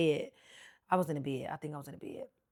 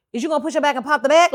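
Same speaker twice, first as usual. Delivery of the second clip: worked up; 7 semitones higher, 11 dB louder, much faster than usual. Real, not acted. Nervous outburst at the doctor.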